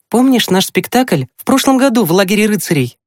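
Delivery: put-on high boy's voice, eager question; boastful younger brother. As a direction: ask a sister eagerly in a high voice. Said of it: "хвастливо произнёс он". (оживлённый вопрос высоким мальчишеским голосом)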